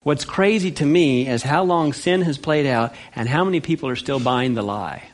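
ordinary delivery

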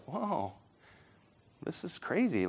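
put-on very soft, stunned kid's aside; hushed amazement. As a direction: say it to yourself in quiet disbelief. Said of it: "and you're like".

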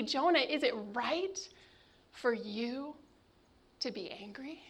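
hushed divine question to Jonah; God's soft question. Acted. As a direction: ask it gently, almost a whisper. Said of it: "God asks".

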